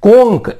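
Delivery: कोंक ending in hard "k"; careful K ending. Shown as a demonstration. Teacher asking for careful reading, कोंक with "k". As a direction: read कोंक carefully with a final क. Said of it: "इसको ध्यान से पढ़िएगा".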